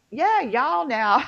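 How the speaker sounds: slow drawn-out country twang; mountain twang. Retold or imitated. On the phone to a coworker, slipping into mountain twang. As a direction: slide into home twang, slow. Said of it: "so I'm like".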